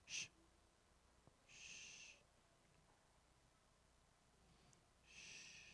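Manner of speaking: long whispered shush; hushing. Real, not acted. Preacher keeps children silent with eyes shut, soft drawn-out shush. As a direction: hush the room very softly.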